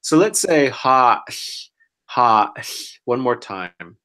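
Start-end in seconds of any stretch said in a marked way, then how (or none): 0.7-2.5 ha, with an open ah vowel; saying ahh. Shown as a demonstration. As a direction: hold the ah in ha open. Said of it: "it only makes the ah sound".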